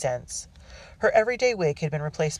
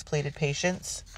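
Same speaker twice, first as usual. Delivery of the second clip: ordinary delivery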